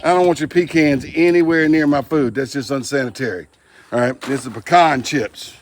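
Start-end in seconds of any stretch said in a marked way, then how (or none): 0.5-1.0 "pecans" said PEE-cans, first syllable stressed; mock the "pecans" that sounds like pee-cans. Shown as a demonstration. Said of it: "Some people pronounce this pecans". 4.5-5.0 "pecan" stressed on the second syllable, puh-KAHN. Shown as a demonstration. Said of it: "That's how we pronounce it around here".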